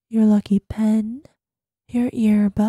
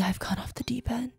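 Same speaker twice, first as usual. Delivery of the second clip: whisper; 10 dB quieter than usual. Acted. Self-aware admission.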